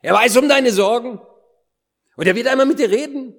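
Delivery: high, loud preaching burst; emphatic proclamation. Real, not acted. Preacher promises the listener a personal word from the Lord, emphatic proclamation. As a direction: ring it out loud and high, driving it home. Sudden emphatic climax of the assurance.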